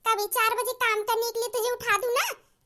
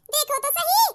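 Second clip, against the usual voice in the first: higher, louder mock alarm to the village; calling for help; 3 semitones higher, 3 dB louder than usual. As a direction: shout for the neighbours in fake panic. Calling villagers about thief.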